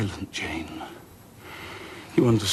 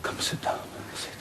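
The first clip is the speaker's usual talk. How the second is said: much softer, whispered begging; whispered plea; 13 dB quieter than usual. Acted. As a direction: beg her softly in a whisper. Rochester pleading in a whisper.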